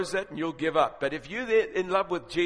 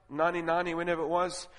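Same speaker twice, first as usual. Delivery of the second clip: normal talking voice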